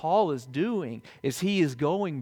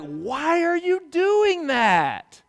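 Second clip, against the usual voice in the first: slow, higher pleading why from a puzzled parent; exasperated parent; 10 semitones higher, 5 dB louder, much slower than usual. Retold or imitated. Parent asking a child who keeps misbehaving, slow exasperated plea. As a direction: ask it like a baffled parent at wit's end. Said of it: "and you're like".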